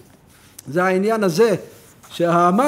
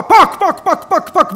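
knocking sound; 7 semitones higher, 6 dB louder than usual. Shown as a demonstration. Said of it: "ככה אני עושה".

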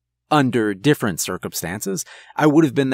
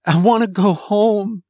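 higher, overwhelmed plea; upset, higher-pitched young voice; 8 semitones higher, 4 dB louder than usual. A narrator's reading. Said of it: "completely overwhelmed".

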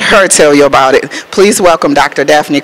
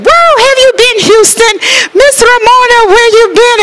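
put-on high, lively Jamaican accent, lightly done; imitating a friend's Jamaican accent, excited and high; her Jamaican accent. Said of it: "with that accent".

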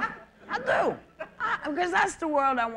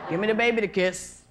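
lowered, lightly voiced campaigning Jesse; a hint of a nodding doll begging; mock campaigning politician; bobblehead politician voice; 7 semitones lower than usual. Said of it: "dolls in the back of cars that go like this".

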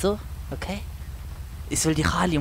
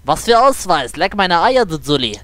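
defiant outburst; 4 dB louder than usual. Pretend. Driver to officer asking for ID, defiant outburst. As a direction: throw the demand back at them. Refuses demand for ID.

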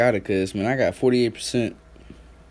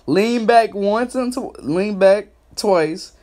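drawn-out instructions; 5 semitones higher, slower than usual. Shown as a demonstration. Telling the chat how to play, slow and drawn out. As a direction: spell out each step slowly.

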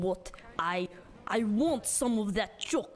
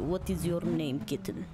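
normal talking voice

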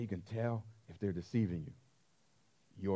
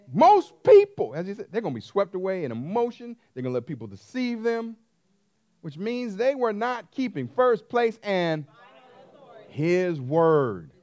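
higher and louder, pounding each phrase; preaching at full force; 6 semitones higher, 8 dB louder than usual. Acted. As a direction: drive the sermon home to the crowd.

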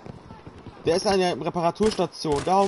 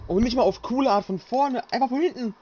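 furious gamer rant; 9 semitones higher than usual. Real, not acted. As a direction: rant about being run over from behind. Run over by vehicle, raging.

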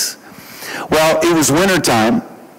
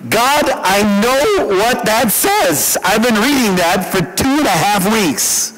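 exasperated protest; 8 semitones higher than usual. Retold or imitated. Pastor protesting to God about a repeated verse, exasperated. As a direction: protest each word in rising exasperation. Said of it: "So now I'm irritated".

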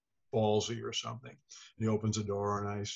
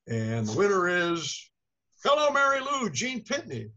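big, high announcer voice rising on Hello; award show announcer; 8 semitones higher, 3 dB louder than usual. Retold or imitated. [name] recalling the awards host naming the winner, in an announcer voice. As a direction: ring out the winner's name like an emcee.

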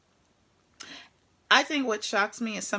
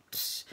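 a barely there hiss, even softer; fainter psst whisper; 8 dB quieter than usual. Retold or imitated. She shows how faint the trucker's psst call is, whisper. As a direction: breathe out a tiny psst.

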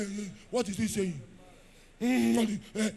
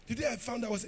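usual speaking voice

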